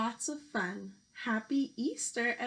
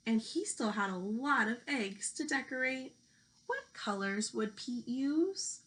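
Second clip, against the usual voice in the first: lively picture-book storytelling voice; reading to children. Acted. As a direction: read it out like a teacher at story time.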